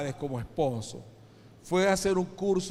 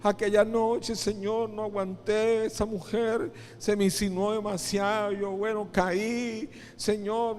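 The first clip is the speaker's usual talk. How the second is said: high, acted crying plea for forgiveness; tearful pleading; 6 semitones higher than usual. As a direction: beg God as if weeping, pitch held up. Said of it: "le decía llorando". (súplica llorosa, imitando a un penitente)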